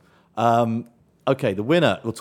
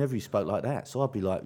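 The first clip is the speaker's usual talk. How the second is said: ordinary talk, nothing marked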